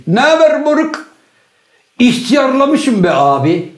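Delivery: high, slow mocking tease of an old man; mock teasing greeting. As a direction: jeer at him in a high drawn-out voice.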